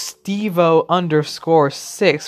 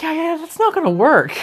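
dismissive DM; 12 semitones higher than usual. Retold or imitated. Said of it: "I'm like".

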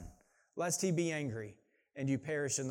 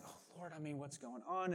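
softly voiced anxious prayer, much quieter; hushed worried plea; 11 dB quieter than usual. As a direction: murmur it like a worried believer.

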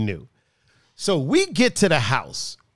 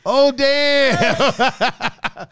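laughing oh damn; 7 semitones higher than usual. Retold or imitated. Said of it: "John just said".

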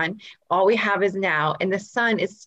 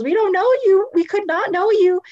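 high, louder playful aside; high-pitched emphasis; 10 semitones higher, 4 dB louder than usual. Real, not acted. Telling listeners to reach out, high and insistent. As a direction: push the voice way up to insist.

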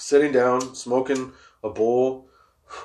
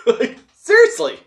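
laughing through the words, much higher and louder; 5 dB louder than usual. Real, not acted. To listeners, laughing at his own point. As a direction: break into a laugh mid-sentence. Laughing while ranting about mistakes.

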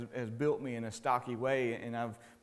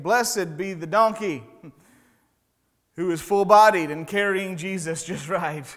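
louder, higher pretend proclamation; mock scripture; 4 semitones higher, 10 dB louder than usual. Preacher teasing the congregation with a fake verse, mock proclamation. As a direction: proclaim a made-up verse with a grin.

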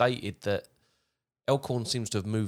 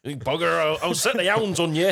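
high, loud threatening yell; angry farmer shout; 7 semitones higher, 6 dB louder than usual. Acted. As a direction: threaten trespassers with the hounds. S1 plays furious farmer.

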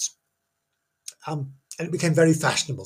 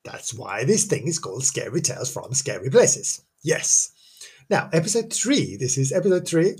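normal talking voice

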